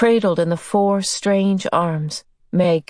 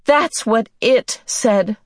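higher-pitched, drawn-out protest; distressed, emphatic protest; crying out; 5 semitones higher, slower than usual. A narrator's reading. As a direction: cry it out in alarm. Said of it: "Meg cried".

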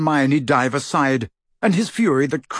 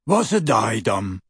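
dim-witted oafish henchman voice; Goyle, gormless; 2 semitones lower than usual. A narrator's reading. Puzzled henchman asks about the diadem, gormless. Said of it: "gormless grin".

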